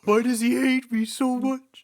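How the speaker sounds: high, loud, plaintive whine; exaggerated high-pitched complaint; mock whining. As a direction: wail it like an aggrieved teenager. Joins in voicing annoyed Annie.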